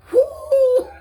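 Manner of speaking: high loud whoop; whoo cry. Real, not acted. Reacting to the bunion video with a loud whoop. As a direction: whoop it out in shock.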